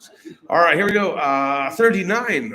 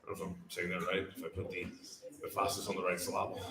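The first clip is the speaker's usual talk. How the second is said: muttered aside; 8 semitones lower, 13 dB quieter, much faster than usual. Real, not acted. Aside doubting own pronunciation.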